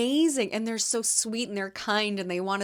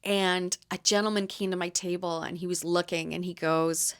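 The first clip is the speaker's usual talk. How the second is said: ordinary talk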